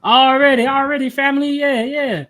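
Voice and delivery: bursts out high and loud, thrilled; pumped the numbers came out right; excited. Real, not acted. Cheering to his family of viewers, excited. Gets his calculation result.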